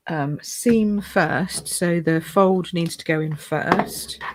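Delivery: ordinary talk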